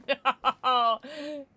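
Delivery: normal talking voice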